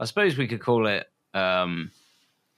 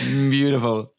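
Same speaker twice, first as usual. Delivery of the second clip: musing hum, then louder; mock Goldblum; 2 semitones higher, 4 dB louder than usual. Co-host joining the Goldblum bit, louder hum. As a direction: savour it with a dreamy hum. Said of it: "it's my Jeff Goldblum".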